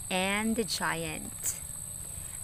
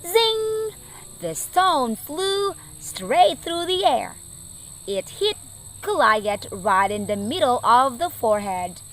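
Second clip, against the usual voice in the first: dramatic storytelling. A narrator's reading. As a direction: tell the battle with rising drama.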